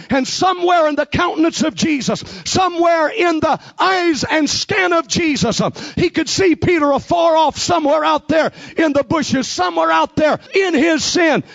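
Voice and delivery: rising, ringing pulpit cadence; hammering preacher's build; full-force preaching. Acted. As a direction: preach it hard to the congregation.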